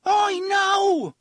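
loud, very high warning; drawn-out desperate scream. A narrator's reading. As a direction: scream to stop the animal. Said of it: "he screamed".

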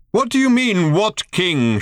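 put-on old man yelling over the herd; shouted. A narrator's reading. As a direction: bellow it, baffled. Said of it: "shouted old Thrashbarg".

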